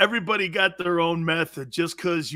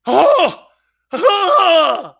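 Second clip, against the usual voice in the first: high, loud drawn oh; hammed-up gasp; mock realization; 12 semitones higher, 12 dB louder than usual. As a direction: ham up the discovery.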